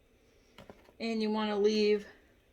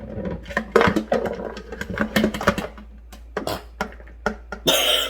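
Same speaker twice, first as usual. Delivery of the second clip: a sudden real sneeze; sneeze, then recover; sneezing. Real, not acted. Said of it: "sorry for the sneeze".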